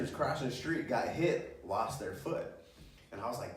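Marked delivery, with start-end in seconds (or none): none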